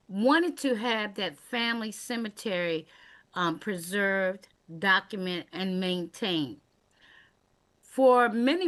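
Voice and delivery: ordinary talk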